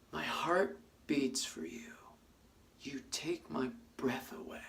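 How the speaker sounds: slow, soft, fading to a whisper; tender hushed words. Retold or imitated. Stranger in the park tenderly tells a lost man, softly slow. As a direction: say it slowly and gently, breath trailing off. Woman looks him in the eyes.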